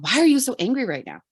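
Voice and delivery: imitating an exasperated partner; mock confrontational. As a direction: challenge them in a rush. Acts out the unhelpful reaction.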